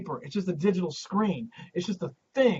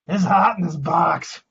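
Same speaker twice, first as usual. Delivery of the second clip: muffled; 7 dB louder than usual. Real, not acted. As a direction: voice muffled by a box. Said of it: "I'm wearing a box on my head".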